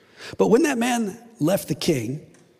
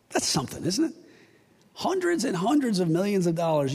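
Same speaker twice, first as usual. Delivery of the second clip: normal talking voice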